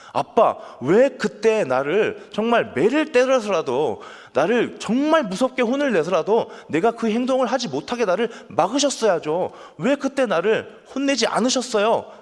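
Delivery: high, loud, pleading reproach; resentful grown child. Acted. Grown child blames father for not scolding, resentful. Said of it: "아빠를 원망할 거야".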